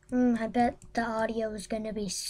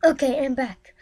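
ordinary talk, nothing marked

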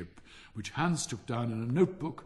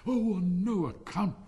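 higher, louder, slow protest; alarmed cry; 8 semitones higher, 5 dB louder, slower than usual. A narrator's reading. Hans hastily gives in to the miller, alarmed cry. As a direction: cry out in eager objection. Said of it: "cried little Hans".

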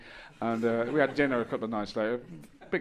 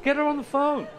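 hammed-up outraged customer; mock outrage; 14 semitones higher than usual. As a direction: play the furious customer asking for the manager. Mock outraged customer demanding manager.

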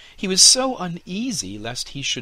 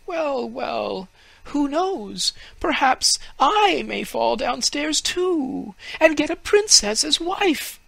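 high, louder awed fir tree voice; a naive tree marvelling aloud; tree's thoughts; 7 dB louder, slower than usual. A narrator's reading. Fir tree marvels at the Humpty Dumpty story, high and awed.

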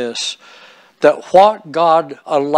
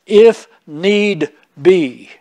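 slow scripture read; 3 semitones higher, 4 dB louder, much slower than usual. Acted. Reading scripture phrase slowly.

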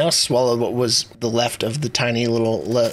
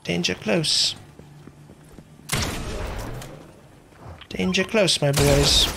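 put-on British accent; 3 semitones higher, 4 dB quieter, slower than usual. Streamer keeping the accent till next kill, mock British. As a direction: play a British soldier-ish accent while gaming. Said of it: "Accent till next kill".

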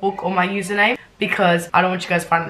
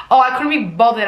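higher, louder sarcastic echo; mocking repeat; 4 semitones higher, 5 dB louder than usual. Writer mocking her own line, sarcastic higher repeat. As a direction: throw the line back with mock nonchalance. Mocks the line just read.

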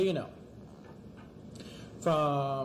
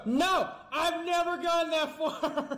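high, laughing cry of loss; despairing yell; 12 semitones higher, slower than usual. Real, not acted. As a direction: yell and laugh at the lost progress. Loses best progress.

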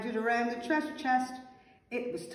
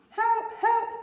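very high, loud cries for help; panicked yell; 13 semitones higher, 6 dB louder than usual. A narrator's reading. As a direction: yell for rescue in storybook panic. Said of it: "they yelled".

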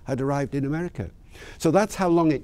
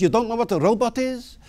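put-on South African accent; 10 semitones higher than usual. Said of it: "in a lovely South African accent".

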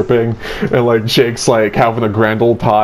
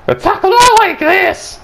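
very high, shrieky character impression; Weiss. Podcaster showing friend an impression, high screechy Weiss voice. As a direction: screech it in a put-on squeal. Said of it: "that's my Weiss impression".

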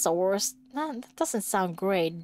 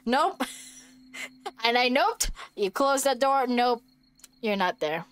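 normal talking voice

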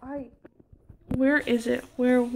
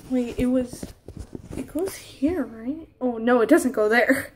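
ordinary talk, nothing marked

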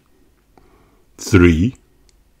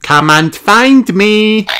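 high, slow, teasing sing-song call; Peppa calling out; 11 semitones higher, 4 dB louder than usual. A narrator's reading. Peppa calling George again from the box, high and slow. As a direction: draw it out to tease him. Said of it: "said Peppa".

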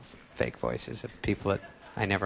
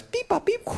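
register beeps. Retold or imitated.

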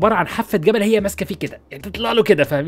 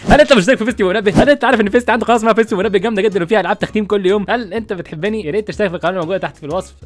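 hammed-up channel plug; 5 semitones higher than usual. Plugs his second channel.

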